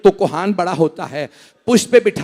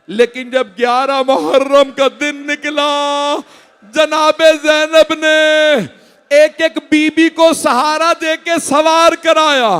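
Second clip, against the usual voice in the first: high, loud, slowed cries of grief; drawn-out grieving cries over the mourners; wailing lament; 10 semitones higher, 7 dB louder than usual. Acted. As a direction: preach the tragedy at full force, wailing. Final climax of the lament.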